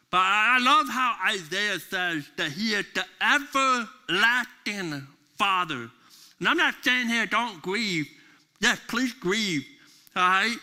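high, loud pulpit preaching; preaching at full force. Acted. Preacher promising grieving listeners God never leaves. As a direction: drive the promise home to the congregation.

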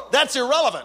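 high-pitched, insistent retelling; emphatic exclamation. Retold or imitated. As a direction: exclaim it to the crowd in disbelief. Repeats pastor's reply emphatically to audience.

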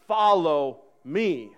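dad insisting to his daughters, slow and stern; firm command. Shown as a demonstration. Slow stern follow me. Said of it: "I mean".

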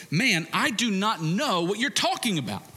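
mild, half-acted irritated denial; Peter's denial. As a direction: brush it off, lightly annoyed. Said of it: "But Peter said".

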